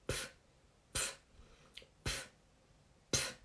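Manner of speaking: short repeated pf puffs, isolated; pf sound drill. Shown as a demonstration. Teacher drills the pf sound for students, puffed out. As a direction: puff out the pf sound on its own. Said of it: "Ó, pfeifen".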